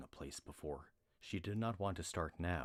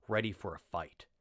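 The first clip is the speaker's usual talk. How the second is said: usual speaking voice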